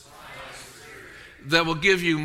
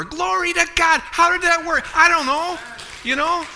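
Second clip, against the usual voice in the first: higher and louder praise; real excitement rising high and loud; excited; 10 semitones higher, 5 dB louder than usual. Real, not acted. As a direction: erupt in joyful praise. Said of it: "I could get excited".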